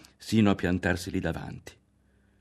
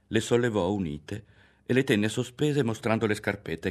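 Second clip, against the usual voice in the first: ordinary delivery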